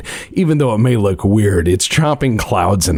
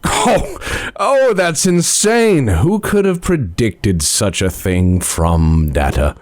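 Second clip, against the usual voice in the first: drawn-out sarcastic gasp; sarcastic governor; slower than usual. Acted. Governor mocks the party's coup warning, slowly.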